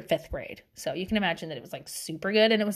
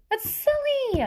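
very high, drawn-up squeak; high-pitched exclamation of disbelief; emphatic silliness; 13 semitones higher, slower than usual. Real, not acted. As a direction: repeat it way up high, dismissively. Emphatic repeat dismissing shame.

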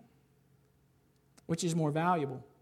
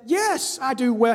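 defiant Jonah; 5 semitones higher, 8 dB louder than usual. Acted. Jonah answers God defiantly.

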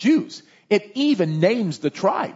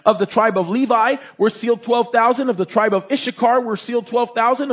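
scripture reading; 5 semitones higher than usual. Acted. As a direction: lay out each tribe like a proclamation. Reads list of tribes.

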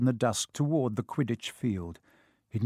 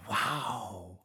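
much softer, breathed-out wow; awed sigh; 4 semitones lower, 10 dB quieter than usual. Acted. Ron seeing the new broomstick unwrapped, soft awed sigh. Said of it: "Ron sighed".

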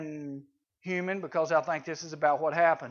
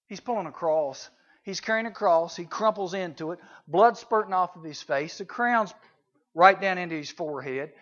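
ordinary talk

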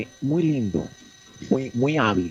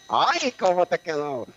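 high, slow, swooning "ay"; flattered gushing reaction; 5 semitones higher, slower than usual. Shown as a demonstration. Host showing how to answer a compliment, swooning. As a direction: gush like you've just been flattered. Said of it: "sino que vos digas".

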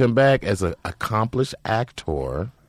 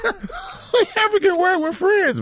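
real laughter while saying it; cracking up at the joke; laughing; slower than usual. Real, not acted. Friend laughing at the African game app. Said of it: "That's hilarious".

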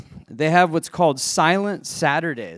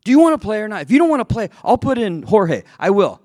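higher, louder mock coach demanding; angry coach; 7 semitones higher, 3 dB louder, faster than usual. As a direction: badger the kid like a riled coach. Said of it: "I'm not gonna do the accent".

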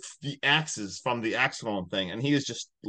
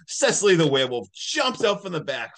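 usual speaking voice

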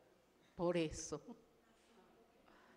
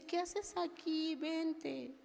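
put-on a light, gentle little-girl voice, higher and softer, slowly; little girl's voice; 5 semitones higher, 6 dB quieter, much slower than usual. A little girl hugging the sulking preacher, soft and high. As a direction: coax her kindly like a small child.

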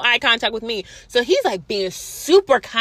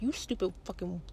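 under the breath, much softer; muttered insult; 3 semitones lower, 16 dB quieter than usual. Retold or imitated. To the officer, a furious insult muttered under the breath. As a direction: bite it off quietly. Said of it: "I'm like".

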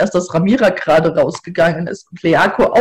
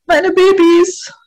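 put-on drunken wail; 12 semitones higher, 3 dB louder, slower than usual. Drunk mother wails for kids.

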